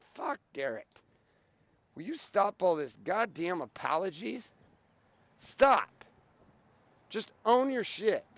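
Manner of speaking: slow, emphatic rebuke, rising to a punched STOP; exasperated self-scolding. Acted. Man telling himself to stop all the apologies, exasperated. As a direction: scold with drawn-out, weighted words.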